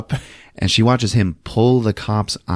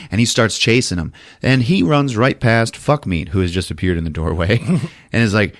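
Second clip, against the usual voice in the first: normal talking voice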